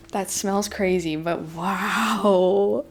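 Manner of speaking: ordinary talk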